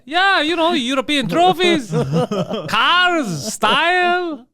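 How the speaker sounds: hammed-up Italian. Joking as an Italian to friends, high hammed-up accent. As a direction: ham up the Italian excuse. Continues mock Italian persona.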